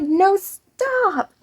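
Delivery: high, delighted no-way squeal; thrilled reply to the girl being seventeen; enthusiastic disbelief. Retold or imitated. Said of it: "I was so enthusiastic".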